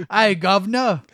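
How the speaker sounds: high, loud, stumbling Cockney; overcooked English accent, tripping over the line; mock Cockney accent. Bad actor redoing coin toss scene, loud mock Cockney. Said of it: "Why do you have an English accent?".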